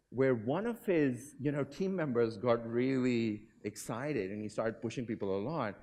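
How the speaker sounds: ordinary talk, nothing marked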